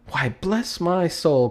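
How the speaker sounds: slow, high exclamation of surprise; startled cry. Acted. Fred, startled at his uncle's arrival, cries out high. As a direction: caught off guard by the visitor. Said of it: "cried Fred".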